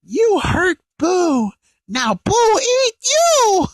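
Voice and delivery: put-on high, slow childish monster voice; Boo voice. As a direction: drag it out like a menacing childlike monster. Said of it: "Boo actually says".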